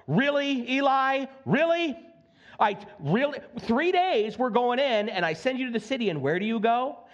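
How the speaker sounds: high incredulous "really?"; scolding the spies in mock disbelief. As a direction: pitch up, tease the unlikely choice.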